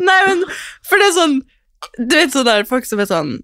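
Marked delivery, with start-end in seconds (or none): none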